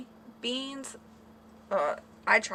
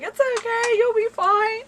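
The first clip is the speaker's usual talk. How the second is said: imitating husband; 13 semitones higher, 7 dB louder than usual. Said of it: "He's like".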